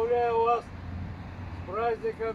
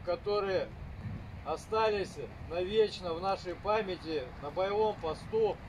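formal speech; 3 semitones higher than usual. Real, not acted. Formal toast as an order.

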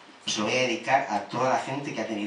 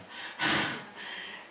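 quiet, voiceless chuckle; small laugh under the breath; soft amused puff after an aside; short laugh; 4 dB quieter than usual. Real, not acted.

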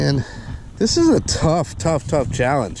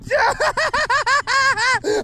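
very high, long shrieking laugh; triumphant laugh. Real, not acted. Fish landed, laughs in triumph.

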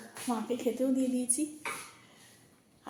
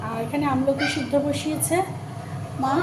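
raised voice over background noise; speaking loudly; 3 semitones higher, 10 dB louder than usual. Real, not acted. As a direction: push your voice over a barking dog. Talking over the barking dog.

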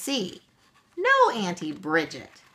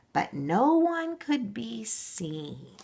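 ordinary delivery

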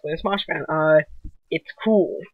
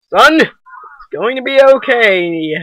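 dad voice; 4 semitones higher, 6 dB louder than usual. Acted. Dad character comforts son.

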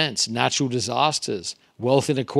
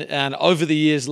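normal talking voice

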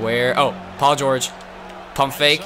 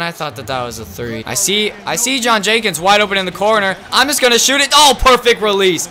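normal talking voice

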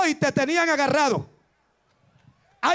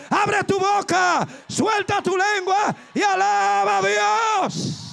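higher, slow, shouted call to praise; slow shouted exhortation; 3 semitones higher, slower than usual. Acted. Preacher urging the congregation to open their mouths, slow and shouted. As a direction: drive the crowd to praise, drawn out.

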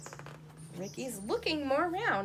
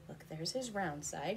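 usual speaking voice

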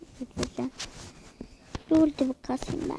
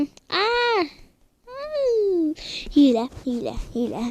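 outraged; 4 semitones higher, slower than usual. Real, not acted. Outraged cry of cheating.

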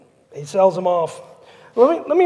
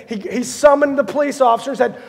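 ordinary talk

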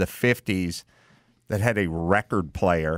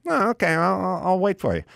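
higher, gentle voice; imitating Leno; 8 semitones higher, faster than usual. Leno telling his friend he'll wait, higher pitched. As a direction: content to wait.

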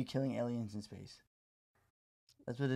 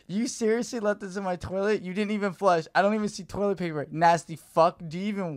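loud furious rant, pitched higher; angry texter; 6 semitones higher, 7 dB louder than usual. Retold or imitated. Stranger texting about shit in his toilet, loud angry rant. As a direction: tear into him like a disgusted stranger. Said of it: "They said".

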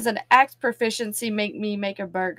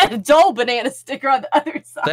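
laughing; 4 semitones higher, 10 dB louder than usual. Real, not acted. Laughing while telling.

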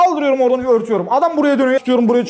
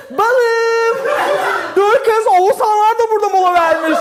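camp excited call; 9 semitones higher, slower than usual. Retold or imitated.

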